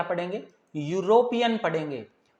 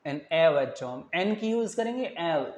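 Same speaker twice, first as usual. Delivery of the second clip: normal talking voice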